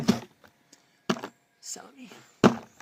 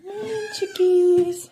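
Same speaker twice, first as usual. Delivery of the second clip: high, loud call across the yard to the chickens; 9 semitones higher, 6 dB louder than usual. Real, not acted. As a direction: sing out a loud, high morning greeting. Calling out to the chickens.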